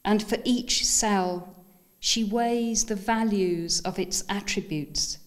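lifted, deliberate recital, pausing after each image; measured poem voice, each phrase set apart; poetry reading. Acted. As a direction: recite it line by line to an audience. Reads second poem aloud.

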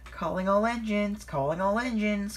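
high, carrying announcement call. A narrator's reading. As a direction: call it out to the whole railway. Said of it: "called Thomas".

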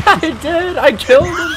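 high, loud gleeful yell; excited shout. Real, not acted. Gamer yelling in triumph after a kill. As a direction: crow over the kill.

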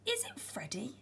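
soft, whispered question; timid storybook whisper; hushed wondering. A narrator's reading. Eddie timidly wonders about giant bear.

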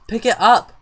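high, loud eager command; excited urging. Real, not acted. Player wants the cat held, urging excitedly. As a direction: urge them on eagerly. Urges Sim to hold cat.

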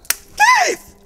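high shouted self-intro; shouted name. Acted. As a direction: yell his own name. Introduces himself.

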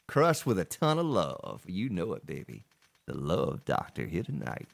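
hint of a low, smooth love doctor; lightly put-on seducer, sinking low. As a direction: drop low and soft, trailing down.

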